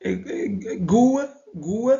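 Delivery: "Gou" sounded alone, loud and deliberate; pronunciation demo. Shown as a demonstration. Teacher showing learners a hard letter, loud deliberate "Gou". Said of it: "ça prononce Gou".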